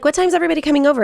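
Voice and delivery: quick, high-pitched retold question; imitated son asking. Son asks mom when everyone arrives, imitated quick high question.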